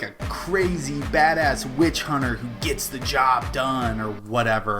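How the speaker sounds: ordinary talk, nothing marked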